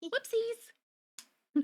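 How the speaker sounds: high cutesy squeak; sweet and silly. Acted. Cutesy voice continues.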